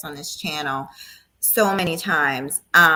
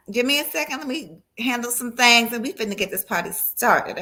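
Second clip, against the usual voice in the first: usual speaking voice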